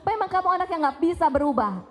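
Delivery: put-on exasperated mother berating her child; hurtful angry scolding. Shown as a demonstration. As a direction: tear into the kid, rapid and cutting. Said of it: "Itu ekspresi kemarahan yang melukai".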